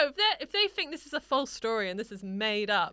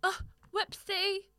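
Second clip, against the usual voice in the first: high, slow playing-dumb whoopsie; mock ditzy oops; mock innocent; 7 semitones higher, slower than usual. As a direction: play the innocent fool, drawn out. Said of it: "I do that a lot".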